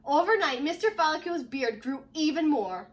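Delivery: ordinary delivery, nothing marked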